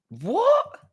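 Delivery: a shrill incredulous what; shocked what. Real, not acted. Streamer can't believe who chat says he looks like, shocked.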